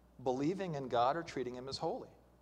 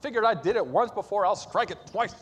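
loud, high, acted exasperation; worked-up retelling of a fed-up outburst; upset Moses; 7 semitones higher, 8 dB louder than usual. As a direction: act out losing patience, hitting the words hard. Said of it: "He gets upset".

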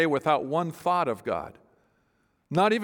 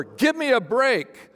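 sarcastic; 7 semitones higher, slower than usual. Shown as a demonstration. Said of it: "Some people think he's sarcastic here".

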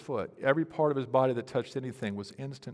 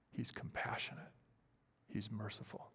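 whispered, much softer reassurance, He's compassionate; 3 semitones lower, 13 dB quieter than usual. Acted. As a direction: breathe it like a secret, He's merciful. Quiet assurance of God's nature.

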